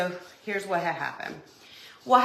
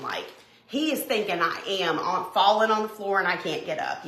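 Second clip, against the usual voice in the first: usual speaking voice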